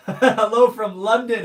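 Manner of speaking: loud, slow, through a laugh; laughing greeting. Real, not acted.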